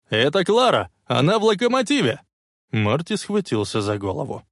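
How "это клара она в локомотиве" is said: high, urgent reply; shouted report. A narrator's reading. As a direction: shout the news into a radio. Shouted reply over the radio. (встревоженный крик в рацию)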